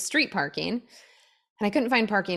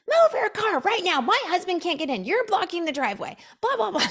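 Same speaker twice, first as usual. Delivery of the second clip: angry mom; 10 semitones higher than usual. Retold or imitated. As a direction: play the furious mom yelling at her. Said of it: "The mom was pissed".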